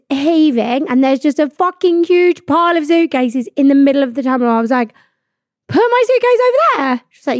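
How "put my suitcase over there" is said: high, squeaky mock disbelief; incredulous repeat. To the check-in lady, a high mocking incredulous echo. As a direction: echo her words back in shocked disbelief.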